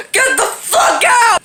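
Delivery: high, drawn-out mock scream; retold outraged yell. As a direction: yell it like the horrified victim. Imitates Marcus throwing Jules out.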